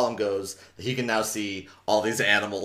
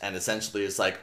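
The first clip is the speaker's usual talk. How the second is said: ordinary talk, nothing marked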